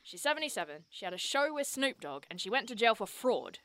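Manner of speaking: normal talking voice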